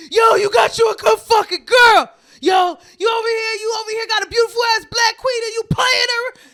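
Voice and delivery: loud angry yell at a cheating man; furious yelling at the boyfriend outside; retold angry yelling. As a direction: shout him down for playing her. Bassy yelling at him outside.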